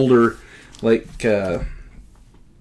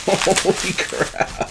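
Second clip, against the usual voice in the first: awed whisper; 6 semitones higher, 6 dB quieter, much slower than usual. Real, not acted. Stunned by another gift comic.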